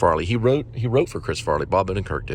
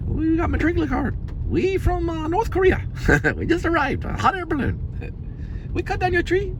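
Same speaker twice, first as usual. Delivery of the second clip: mock Chinese accent. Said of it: "Chinese people pretending like they're Mexicans".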